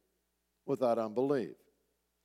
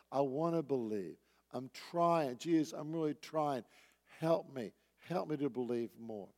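sincere plea; 3 semitones lower, 7 dB quieter than usual. Retold or imitated. Father's sincere plea, voiced softly.

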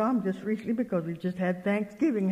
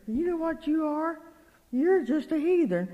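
raised, scolding pitch; retold rebuke; 8 semitones higher than usual. Speaker to her fretting friend, retold scolding. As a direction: tell her off, voice lifted high.

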